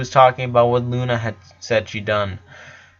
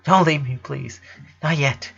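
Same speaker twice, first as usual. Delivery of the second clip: tearful plea; 3 semitones higher than usual. A narrator's reading. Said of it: "his sister's plea".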